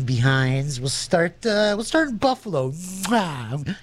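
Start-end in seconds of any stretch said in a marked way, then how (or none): 2.7-3.8 mock kiss. DJ blowing a kiss to a sponsor, hammed up. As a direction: blow a hammy kiss at the sponsor. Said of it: "my Richard Dawson imitation".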